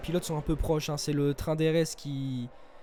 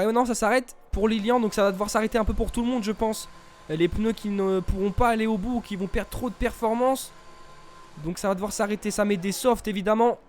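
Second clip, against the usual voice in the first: excited commentator; 5 semitones higher, 4 dB louder than usual. Real, not acted. Live sim racing commentary.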